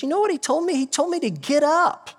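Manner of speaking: high-pitched, animated acted quote; excited retelling. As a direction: voice an apostle bursting to share news, way up high. Acting Peter telling the other apostles.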